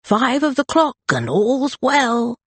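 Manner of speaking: watchman's call. Acted. Watchman calling the hour beyond the garden wall, slow. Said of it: "the watchman shouted".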